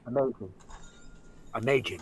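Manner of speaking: "amazing" said low with the botched middle sound; low echo of a flubbed "amazing"; repeated slip. Retold or imitated. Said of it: "came out of my mouth".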